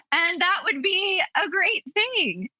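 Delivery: high-pitched, said through laughter; amused, laughing. Real, not acted. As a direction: let a laugh carry the line up high. Laughing at her own wish.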